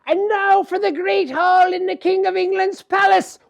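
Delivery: loud, high exultant cry; fairies crying out. Acted. Fairies crying out as they fly to the palace. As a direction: shout it like a grand announcement. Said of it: "the fairies cry out".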